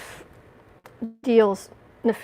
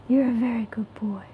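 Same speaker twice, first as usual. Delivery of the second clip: whispering to cat. Real, not acted. Owner whispering praise to her cat. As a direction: breathe it to the kitty.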